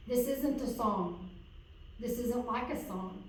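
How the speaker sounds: normal talking voice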